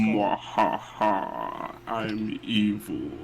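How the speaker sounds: slow, soft mock evil gloat; sneering panto villain. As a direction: purr a hammy villain line. Player jokes about becoming the monster.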